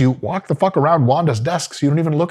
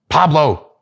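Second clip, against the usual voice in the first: calling upstairs; 5 semitones higher, 5 dB louder than usual. Retold or imitated. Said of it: "And you're like".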